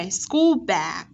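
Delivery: slow "school bag" with held l; teacher models "school bag", curling the tongue on l; curled-tongue L. Shown as a demonstration. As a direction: draw out both words of "school bag". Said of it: "sẽ uốn cong lưỡi này để phát âm".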